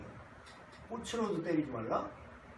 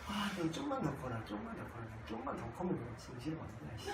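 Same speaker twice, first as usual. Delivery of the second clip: much softer, grumbled half-joking threat; muttered grudge; 5 semitones lower, 11 dB quieter than usual. Retold or imitated. Annoyed father muttering at his child, soft and grumbling. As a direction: grumble it to yourself, holding back annoyance. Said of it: "지금 벼르고 있는데".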